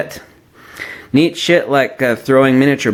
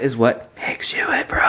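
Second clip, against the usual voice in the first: hushed whisper; 6 dB quieter than usual. Real, not acted. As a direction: whisper the final point. Whispered punchline.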